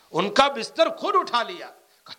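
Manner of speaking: ordinary delivery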